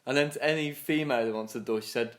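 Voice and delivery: ordinary talk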